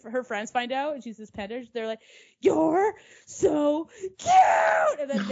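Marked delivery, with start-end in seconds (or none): 2.4-5.0 slow, loud, high cooing squeal; gushing shriek. Retold or imitated. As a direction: gush over something adorable. Friends fawn over the panda.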